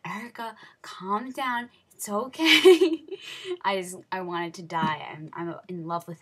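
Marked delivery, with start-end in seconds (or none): 0.0-3.0 mom's reassurance, rising to a loud, high finish; slow, drawn-out last word, climbing high; imitating mom. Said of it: "My mom's like". 3.1-3.6 short, high giggle; quick embarrassed laugh. Real, not acted. Laughs after imitating mom.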